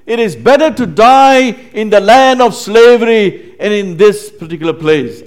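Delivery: loud, high-pitched bitter protest; blaming complaint. Acted. Israelites telling Moses they regret following him, blaming complaint. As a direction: blame him at the top of your voice. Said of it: "their complaint. They were blaming Moses".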